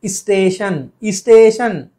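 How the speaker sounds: स्टेशन said as "isteshan"; added vowel. Shown as a demonstration. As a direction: put an ee sound before स्टेशन. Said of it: "बहुत से लोग करते हैं".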